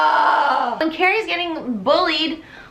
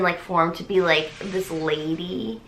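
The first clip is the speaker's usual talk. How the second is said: ordinary talk, nothing marked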